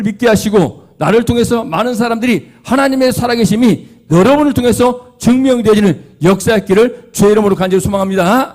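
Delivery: fervent preaching. Real, not acted. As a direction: press the hope on the congregation with force. Preaches toward fervent wish. (간절하고 힘찬 설교)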